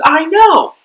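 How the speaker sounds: high, loud outburst of agreement; excited agreement. Retold or imitated. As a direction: blurt it out in emphatic, amazed agreement. Atheist's eager agreement, acted out.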